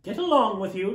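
a brother brushing off teasing; grinning retort. Acted. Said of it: "retorted Peter, grinning".

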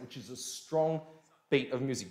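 ordinary delivery

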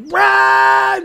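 loud wordless mock battle cry; goblin scream. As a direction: let out a goblin's roar. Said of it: "just screaming".

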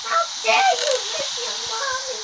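high, loud scolding mother; angry mommy voice. Pretend. Child playing mom, scolding loudly and high.

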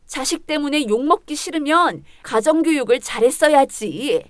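ordinary talk

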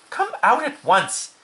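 high, slow scolding order; crying out sternly. A narrator's reading. Mother ordering her son out, high stern cry. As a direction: cry out a firm command. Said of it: "cried Missus Arable".

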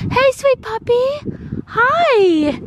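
high, sweet puppy talk. Real, not acted. Mom coaxing a shy puppy closer, high baby talk.

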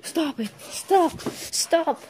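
high cries bursting into a shout; panicked pleading. Acted. Pleading with clown to stop, panicked. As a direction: beg the attacker to stop, frantic.

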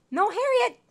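high, loud cry to a pet; exclaimed telling-off; alarmed scolding. Real, not acted. Scolding the game dog.

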